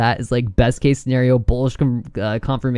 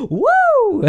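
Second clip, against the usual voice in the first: high cheer; 4 dB louder than usual. Real, not acted. Cheering the chart.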